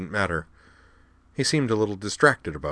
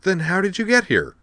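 high-pitched, baffled question; amazed and baffled; 12 semitones higher, 4 dB louder than usual. A narrator's reading. Utna questioning her unexpectedly arrived friend, amazed and baffled. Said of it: "mingled amazement and bafflement".

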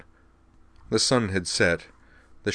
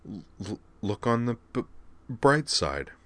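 broken, stuttered consonants; nervous stutter, cheering Juri up; 5 semitones higher than usual. Acted. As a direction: stammer through each first sound.

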